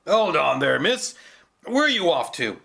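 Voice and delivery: hold-it-right-there shout; gruff challenge. Acted. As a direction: stop her at the gate with a bark. Guard stops the girl gruffly.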